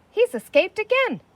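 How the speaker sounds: alarmed realisation. Acted. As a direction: spot him and cry out.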